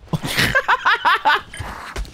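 very high staccato cackle; high-pitched run of laughter; delighted laugh. Real, not acted. Gamer after landing a hit, high staccato laugh.

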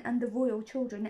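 usual speaking voice

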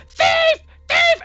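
put-on very high, piercing old-lady shriek; screaming old lady. Old lady catching an intruder with a frying pan, high scream. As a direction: scream it out high and loud like an alarmed old woman. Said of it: "And she starts screaming".

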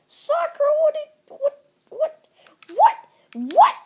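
high yelled what what; shouting what. Real, not acted. Kid yelling at a game character, high shout. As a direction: yell at the screen in disbelief.

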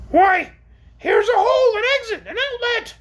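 very high, loud orc voice; exclaiming orc. A narrator's reading. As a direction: call out an excited discovery in a high creature voice. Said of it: "exclaimed the orc from above".

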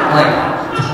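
loud breathy laugh of embarrassment; loud, embarrassed burst of laughter. Real, not acted. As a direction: laugh it off, mortified. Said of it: "totally embarrassed".

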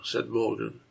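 ordinary delivery

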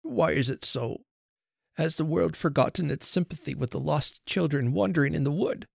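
near tears. A narrator's reading. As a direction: plead sorrowfully, rising to the end. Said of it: "I could see the tears start".

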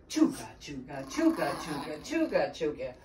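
low, lightly voiced train chug; train call. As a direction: chant it in an even train rhythm. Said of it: "make the call of a wild train".

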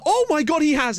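high, astonished goal reaction; excited disbelief. Real, not acted. Gamer thinking his player has scored, thrilled. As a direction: burst out in amazement, pitch way up.

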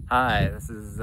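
loud, upbeat show-host opener; TV host intro. Pretend. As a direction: announce it like a travel show. Mock show intro, take one.

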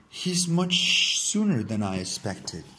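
plain reading voice

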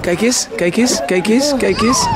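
slow, singsong coaxing. Real, not acted. Parent coaxing sad son toward a surprise, slow singsong. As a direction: draw him out slowly.

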